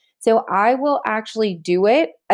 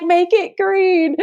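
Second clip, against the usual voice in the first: high, slow, drawn-out cheer; excited urging; 10 semitones higher, much slower than usual. Retold or imitated. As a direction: cheer it on like a hype coach. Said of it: "I'm like".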